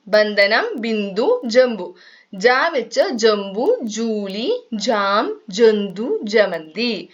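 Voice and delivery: put-on slow, word by word, letting children write; slow dictation. Shown as a demonstration. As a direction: spell out each word slowly for the class to copy. Dictates example words slowly. (പതുക്കെ പറഞ്ഞു കൊടുക്കുന്ന രീതിയിൽ)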